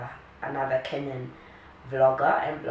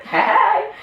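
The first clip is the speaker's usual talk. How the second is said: laughing; 8 dB louder than usual. Real, not acted. Giggling after silly line.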